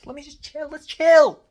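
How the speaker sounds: high-pitched mock calm bursting into a yell; sarcastic chill. Caller stuck on hold for twenty minutes, sarcastic high outburst. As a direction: start small and high, then blast the last words.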